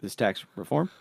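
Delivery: bad Obama impression. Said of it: "here's my really bad Obama".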